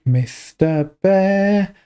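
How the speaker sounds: put-on high, very slow, sing-song child voice; childlike voice. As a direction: read it like a small child. Child's line read out.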